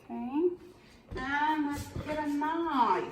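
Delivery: put-on Australian accent. Cooking host reaching for a knife, in a mock Australian accent. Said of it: "Do we all say knife with an Australian accent?".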